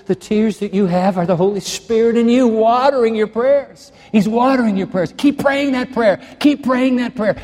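high, ringing preaching cry; preaching fervently. Acted. Preacher urging the weeping congregation to keep praying. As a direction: lift the congregation up, urgent.

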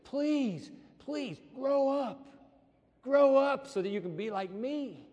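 high, drawn-out plea; earnest preacher's begging; pleading. Acted. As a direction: plead like a parent with a child. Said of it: "is pleading with us".

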